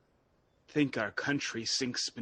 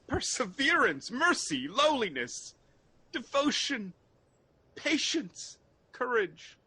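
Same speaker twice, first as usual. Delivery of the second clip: rising intensity; 9 semitones higher than usual. Acted. Malcolm listing virtues, rising.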